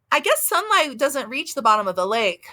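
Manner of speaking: ordinary talk, nothing marked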